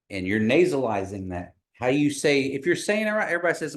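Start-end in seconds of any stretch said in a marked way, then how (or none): none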